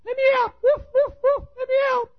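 loud, very high yapping woofs. Acted. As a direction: bark frantically like a tiny dog. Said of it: "Chihuahua said".

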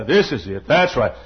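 deep, punchy preacher impression; Lee Robertson. Imitating an admired preacher's favorite phrases, deep and emphatic. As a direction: drop low and stress each opening word. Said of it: "And his voice, deep".

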